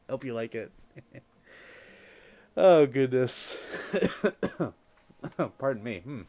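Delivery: ordinary delivery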